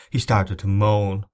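usual speaking voice

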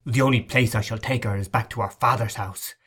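plain reading voice

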